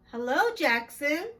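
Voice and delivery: usual speaking voice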